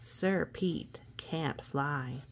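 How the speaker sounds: ordinary delivery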